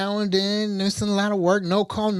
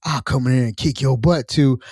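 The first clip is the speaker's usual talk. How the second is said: tough coworker voice; 7 semitones lower than usual. Retold or imitated. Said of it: "she had the perfect switch up".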